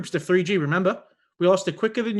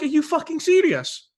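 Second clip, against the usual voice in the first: high-pitched, indignant challenge; retold incredulous outrage; 9 semitones higher than usual. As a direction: re-enact being outraged and disbelieving.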